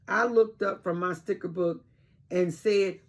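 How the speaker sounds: plain reading voice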